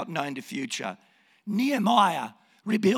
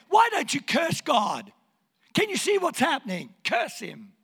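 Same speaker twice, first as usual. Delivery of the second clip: high-pitched, urgent imitation of a wife; exasperated wife; 6 semitones higher than usual. Job's wife urging him to curse God, exasperated.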